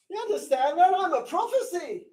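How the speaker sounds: high-pitched, urgent appeal; impassioned and high, falling away in volume; fervent insistence. Real, not acted. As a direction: press the point in a high voice. Pressing the point after the shout.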